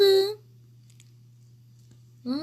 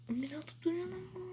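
fast, much softer whisper; 3 semitones higher, 13 dB quieter, much faster than usual. Real, not acted. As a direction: whisper the same complaint quickly. Repeats the complaint whispered.

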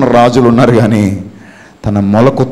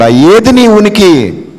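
slow, loud challenge; 7 semitones higher, 4 dB louder, much slower than usual. Acted. Preacher challenging the listener slowly and loudly. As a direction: drag it out, loud and high. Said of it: "repeated question, marked take".

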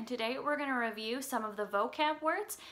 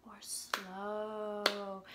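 slow; 3 semitones lower, 6 dB quieter than usual. Shown as a demonstration. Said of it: "we talked about tempo".

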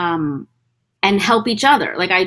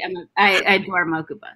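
ordinary talk

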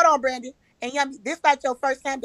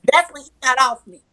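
ordinary talk

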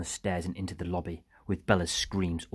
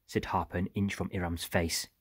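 ordinary delivery, nothing marked

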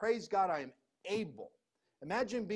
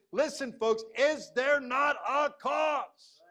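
indignant shout; 6 semitones higher, 6 dB louder, slower than usual. Retold or imitated. David shouting at Philistine.

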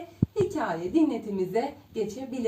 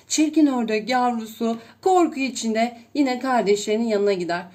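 plain reading voice

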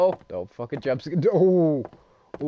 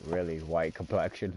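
ordinary talk

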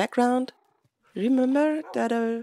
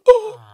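high, loud startled oh; startled cry; 13 semitones higher, 13 dB louder than usual. Real, not acted. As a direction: yelp in sudden surprise. Startled mid-game.

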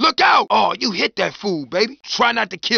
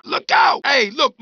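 ordinary talk

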